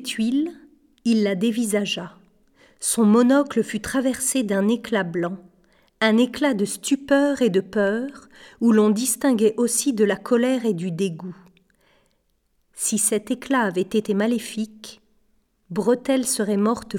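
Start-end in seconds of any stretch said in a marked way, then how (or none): none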